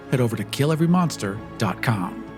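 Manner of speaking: usual speaking voice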